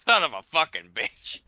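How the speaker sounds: replaying a teammate's rage as a hammed-up curse; imitating an outburst. Host mimics a gamer's sudden rage, mock furious. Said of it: "it went from somebody who's just kinda casually".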